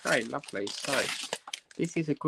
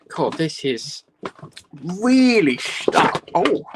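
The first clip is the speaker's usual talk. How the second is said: straining; 4 semitones higher, 7 dB louder, slower than usual. Real, not acted. Struggling to open packaging.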